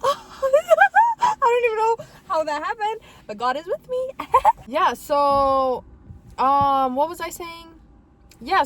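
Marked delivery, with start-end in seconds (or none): 0.0-4.1 high, loud laughter through the words; laughing. Real, not acted. To viewers about her parking, laughing hard. 4.1-4.6 high burst of laughter. Real, not acted. Laugh after parking joke.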